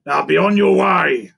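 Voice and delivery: dismissive crocodile. A narrator's reading. Crocodile sending the monkey away, loud dismissal.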